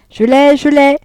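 high, loud excited cry; calling from afar. A narrator's reading. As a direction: call it out to someone across the room. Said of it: "Elle cria de loin". (crié de loin, voix haute et forte)

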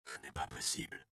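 low, soft stunned murmur. A narrator's reading. Andreas murmurs in shock at the terrible sight, softly. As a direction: breathe it out in disbelief. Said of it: "murmura-t-il".

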